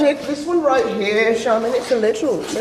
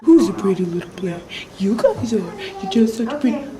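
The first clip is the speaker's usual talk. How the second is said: talking to a plant; 2 semitones lower, faster than usual. Real, not acted. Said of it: "Who you talk to?".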